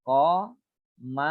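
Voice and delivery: Qamarun said slowly and clearly; slow qamarun demo. Shown as a demonstration. Teacher naming huruf qa example, slow Qamarun. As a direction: stretch out Qamarun for students. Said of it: "huruf qa".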